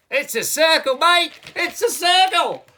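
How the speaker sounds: high, very loud Aussie yelling; frenzied hammed-up Aussie; overexcited Australian puppet. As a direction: shout it in wild excitement, broad Australian. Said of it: "Calm down".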